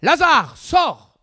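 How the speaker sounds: "Lazare, sors!" called out high and loud; loud cry. A narrator's reading. Jesus calling Lazarus out of the tomb, loud cry. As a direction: command the dead man to come out. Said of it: "il cria d'une voix forte".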